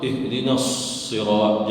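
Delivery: ihdinas sirat with a long held s between words; doubled sad. Shown as a demonstration. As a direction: press and sustain the s joining "ihdinas sirat". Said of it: "di situ ada syaddah".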